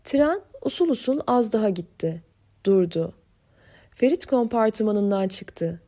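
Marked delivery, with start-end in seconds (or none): none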